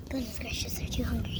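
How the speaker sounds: hushed whisper, no voice; whispering so the birds stay calm. Real, not acted. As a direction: whisper it under your breath. Whispered aside while feeding birds.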